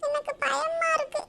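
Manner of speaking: put-on high, whimpering child's plea; tearful little-girl dread, acted; frightened child. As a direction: whine fearfully to father, drawing out the words. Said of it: "ஏன் ஜில்லு பயப்படுற".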